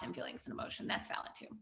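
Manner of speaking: breathy whispered aside; whispering. Real, not acted. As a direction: drop to a whisper. Soft aside after question.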